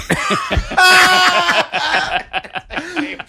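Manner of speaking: long, high-pitched cackle; real burst of laughter. Real, not acted. Laughing at the punchline.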